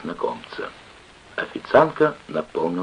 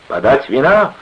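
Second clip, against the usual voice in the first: loud, higher call for service; shouted order; 7 semitones higher, 5 dB louder than usual. A narrator's reading. Clerk ordering wine, shouting. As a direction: call out for drink. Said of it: "крикнул писарь".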